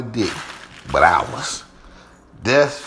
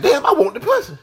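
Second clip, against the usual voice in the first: laughing admission. Retold or imitated. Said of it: "now I'm like".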